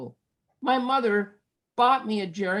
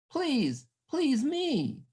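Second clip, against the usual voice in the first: higher, softer begging; pleading; 7 semitones higher, 3 dB quieter, much slower than usual. A narrator's reading. The young drummer begging to be taught, mildly pleading. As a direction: plead for the lesson, a touch of begging. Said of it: "he pleaded".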